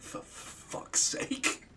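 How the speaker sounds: slow, breathy, exasperated mutter under the breath. Retold or imitated. Guardian fed up with the girl's literal obedience, muttering slowly under his breath. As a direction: let it drain out of you, slowly and unvoiced. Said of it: "And he's like".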